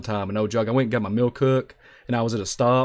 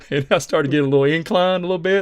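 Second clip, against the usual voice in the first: usual speaking voice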